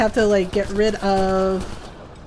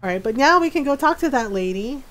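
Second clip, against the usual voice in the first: ordinary talk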